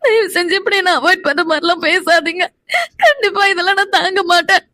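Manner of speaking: sobbing, desperate plea; crying hard while pleading; tearful begging. Acted. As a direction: beg through tears not to be shut out. Crying wife begs husband.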